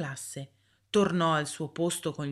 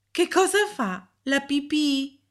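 put-on higher child's voice, puzzled; child's question; 8 semitones higher, 4 dB louder than usual. A narrator's reading. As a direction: ask it like a curious boy. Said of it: "chiese Kenny". (voce di bambino più acuta, incuriosito)

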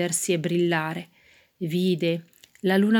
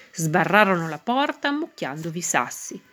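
usual speaking voice

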